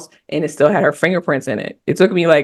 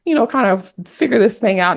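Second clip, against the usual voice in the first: quoted thanks; 10 semitones higher than usual. Retold or imitated. Retelling thanks to her friend.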